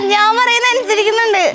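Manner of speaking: high-pitched, delighted remark; pleased amazement. Real, not acted. Interviewer noticing the boy obeys her, delighted.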